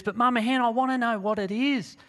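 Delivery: high, whining little-one insistence; persistent eaglet. Acted. Eaglet pestering mama hen for an answer, persistent. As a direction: nag mum in a high pleading voice.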